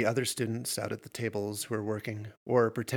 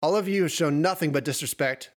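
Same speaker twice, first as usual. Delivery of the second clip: loud, raised-pitch telling-off; angry scolding; 5 semitones higher, 6 dB louder than usual. A narrator's reading. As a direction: scold the students and order them out. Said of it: "Aiden whined".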